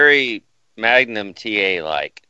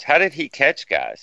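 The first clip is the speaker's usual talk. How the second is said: ordinary talk, nothing marked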